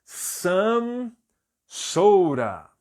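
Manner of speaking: "some" held high, pause, loud falling "soda"; careful model pronunciation. Shown as a demonstration. Teacher gives class the English answer, word by word. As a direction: model "some" and "soda" as two separate stretched words. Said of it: "eu vou dizer".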